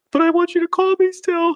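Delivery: very high, put-on whiny plea; mock his younger self begging; squeaky hammed-up pleading; pleading self-mockery. Imitating his past self pleading.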